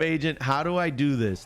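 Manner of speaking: usual speaking voice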